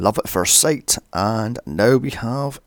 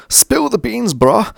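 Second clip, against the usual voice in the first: high, loud mock California lingo; bad Californian accent; 9 semitones higher, 4 dB louder than usual. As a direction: do a hammed-up Californian bro. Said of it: "as bad as that Californian accent".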